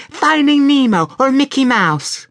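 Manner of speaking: put-on high, chiming little girl; sing-song high girl voice; chiming child voice. A narrator's reading. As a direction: chime it out like a small child. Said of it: "in her delightfully chiming voice".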